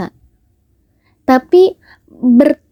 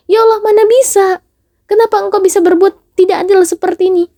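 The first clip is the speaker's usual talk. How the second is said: desperate protest; 7 semitones higher than usual. Acted. Servant protests injustice.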